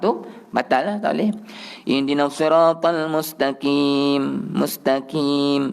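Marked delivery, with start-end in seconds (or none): 1.9-5.7 slow recitation with "mustaqim" said as "mustakim"; too thin. Shown as a demonstration. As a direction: draw out "mustaqim" with a thin front k. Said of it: "nipis sangat mustaqim".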